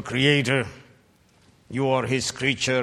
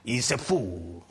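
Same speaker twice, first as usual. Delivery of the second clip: ordinary talk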